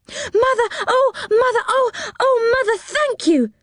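put-on high, excited childish cries; delighted children thanking their mother, high and excited; children crying out. A narrator's reading. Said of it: "cried the children".

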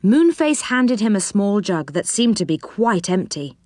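usual speaking voice

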